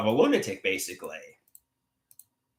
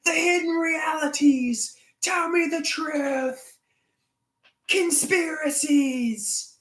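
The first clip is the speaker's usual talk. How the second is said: high, strained raving as a lunatic; hammed-up conspiracy freak-out; mock madman; slower than usual. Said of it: "somebody needs to flip out".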